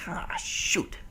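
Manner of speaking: breathless whispered letdown; soft whispered disappointment; whispered curse. Retold or imitated. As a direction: whisper it under your breath. Disappointed aside.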